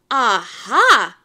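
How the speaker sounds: punchy, triumphant aha; loud storybook exclamation; exclaimed shout. A narrator's reading. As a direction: shout it out like a discovery. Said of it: "shouted handsome Big Anthony".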